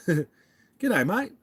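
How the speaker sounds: laughing Aussie g'day mate; amateur Aussie accent; Australian accent attempt. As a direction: laugh through a bad Aussie greeting. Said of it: "I sound like an idiot American".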